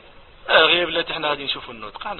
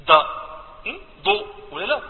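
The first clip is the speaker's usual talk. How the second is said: usual speaking voice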